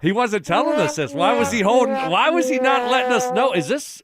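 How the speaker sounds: high, loud, teasing indignation; mock outrage. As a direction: rile him up with fake shock.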